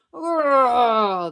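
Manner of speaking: loud growling roar; bear roar. Acted. Cranky bear biting Dad, loud roar. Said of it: "the bear growled".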